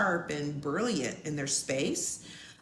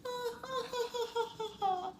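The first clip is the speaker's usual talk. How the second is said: high giggle; 8 dB quieter than usual. Real, not acted. Laughing about charismatic date.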